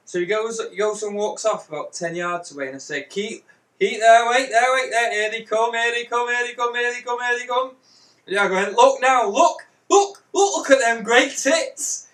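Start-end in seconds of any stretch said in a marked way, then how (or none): 3.0-7.7 raised, repetitive calling-out; mock build-up. Retold build-up, calling from a distance. 8.7-12.1 high, loud mock excitement; excited calling out. Retold calling out to distract him.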